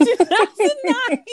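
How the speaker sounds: real laugh bursting through the words. Real, not acted. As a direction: crack up halfway through the quote. Cracking up while quoting the line.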